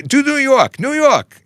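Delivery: put-on very high-pitched kid voice, demanding; kids' pestering request. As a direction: mimic children clamoring for a party trick. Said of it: "my kids were asking me".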